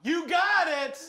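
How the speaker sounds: high, loud game-show win call; triumphant shout. Real, not acted. Host hails the contestant's correct answer, triumphant shout. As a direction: shout it like a winner's bell.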